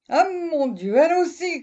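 high, loud cry of dismay; stunned cry. Acted. Farmer hears his goat wants to leave, loud stunned cry. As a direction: cry out in shock. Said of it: "cria monsieur Seguin stupéfait".

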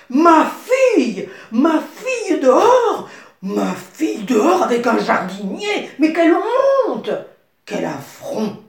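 very loud, high, outraged roar; furious outburst. Acted. The king catching his daughter with the gardener, furious shout.

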